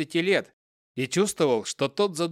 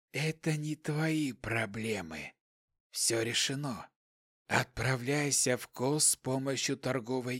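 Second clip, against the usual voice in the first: hissing voice; 3 semitones lower, 9 dB quieter than usual. A narrator's reading. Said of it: "Шипящий голос не выражал никаких эмоций".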